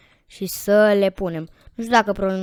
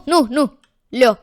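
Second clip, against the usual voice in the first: ordinary talk, nothing marked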